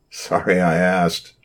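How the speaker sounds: slow, wry half-smiling line; half smile. A narrator's reading. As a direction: say it with a rueful grin. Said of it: "said with a half smile".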